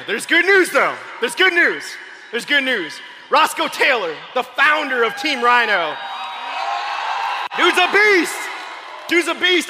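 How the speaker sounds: high, loud hype-man shout; big, punchy rally announcing; excited hype. Real, not acted. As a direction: fire up the room. Hyping up a star team founder.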